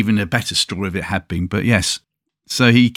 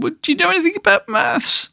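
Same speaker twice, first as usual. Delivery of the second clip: put-on high child's voice asking doubtfully; a small kid sizing up the old man; cheeky little boy. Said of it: "The little boy said".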